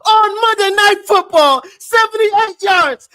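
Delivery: high, loud victory yell, slowing on the yards; triumphant shout. Real, not acted. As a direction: bellow it like the game just turned. Celebrating big play, yelling.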